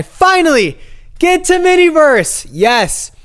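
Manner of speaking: relieved, high-pitched cheer; triumphant. Real, not acted. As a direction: shout it like a finish line. Finally arriving after long search.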